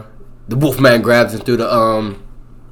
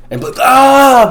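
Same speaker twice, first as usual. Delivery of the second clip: crash yelp; 14 semitones higher, 5 dB louder, slower than usual. Retold or imitated. Blake crashes the truck.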